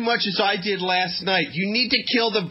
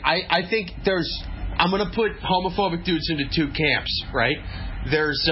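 ordinary talk, nothing marked

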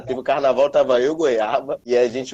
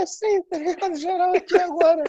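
squeaky high-pitched voice; chipmunk voice; faster than usual. Pretend. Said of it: "a gente ia tá falando com a voz fininha".

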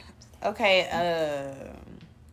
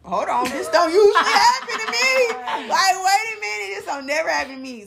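high, loud disbelief; hammed-up, high-pitched alarm; mock alarm; 11 semitones higher, 4 dB louder than usual. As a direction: act out startled protest at your own body. Acting out alarm at getting sick.

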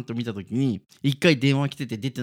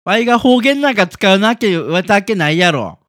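loud, higher, hammed-up Kansai dialect; mock Kansai denial; 6 semitones higher, 12 dB louder than usual. Co-host loudly denies using Kansai dialect, hammed-up. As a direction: bluster in over-the-top Kansai. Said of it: "いやいや、使ってるんですよ".

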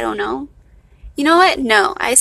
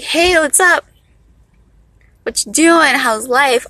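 loud, higher-pitched hey to a friend; casual, informal greeting; 4 semitones higher, 4 dB louder than usual. Acted. Speaker greeting people she knows, casual and informal. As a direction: greet a pal with easy, lifted energy. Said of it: "but rather I'll be like".

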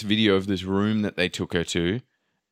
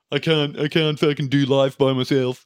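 high, strained mock wail; hammed-up helpless plea; 5 semitones higher, 3 dB louder than usual. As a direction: wail it like you can't cope. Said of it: "that's what I feel like".

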